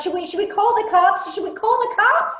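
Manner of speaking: panicking after seeing a crash on video; panicked. Acted. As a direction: babble in rising panic.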